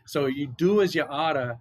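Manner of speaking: put-on Boston accent, chanted like a memory rhyme; Boston-accented mnemonic. Shown as a demonstration. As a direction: drop the R's like a Bostonian. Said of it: "try to do my best Boston accent".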